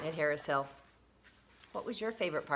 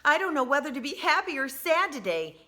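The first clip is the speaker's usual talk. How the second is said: put-on torn child; 7 semitones higher, 5 dB louder than usual. A narrator's reading. Child voiced in read-aloud.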